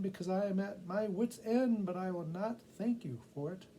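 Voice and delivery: a tired mother giving in crossly; exasperated woman. Acted. Woman at wit's end.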